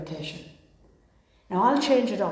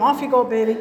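playful loud order; 4 semitones higher, 9 dB louder than usual. Retold or imitated. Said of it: "my wife's like".

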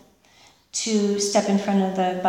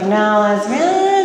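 dismissive; 7 dB louder, much slower than usual. Retold or imitated. Mocking own old dismissal.

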